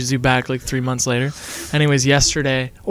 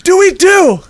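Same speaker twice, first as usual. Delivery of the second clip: very high, loud, drawn-out shout; desperate yell; 14 semitones higher, 7 dB louder, slower than usual. A narrator's reading. Marty argues back at Nikki, yelled. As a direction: shout it slowly at full pitch. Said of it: "Marty yelled".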